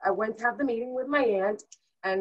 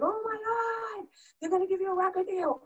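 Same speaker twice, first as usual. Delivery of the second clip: high, squealing mom overjoyed; mother shrieking over the contract letter, high and excited; mom's excited scream; 11 semitones higher than usual. Retold or imitated. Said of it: "all I hear her is screaming".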